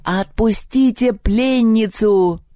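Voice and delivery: drawn-out high call; shouted command. A narrator's reading. Eagle ordering his birds to free captive, drawn-out shout. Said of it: "и закричал".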